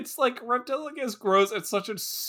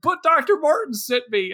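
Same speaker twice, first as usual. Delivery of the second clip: pleading; 8 semitones higher, 4 dB louder than usual. Retold or imitated. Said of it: "And he goes".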